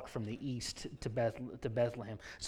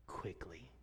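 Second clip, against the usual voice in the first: low, hushed quickly; breathy low whisper; 9 semitones lower, 15 dB quieter than usual. Acted. Refrain whispered.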